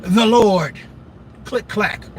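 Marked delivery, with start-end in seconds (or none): none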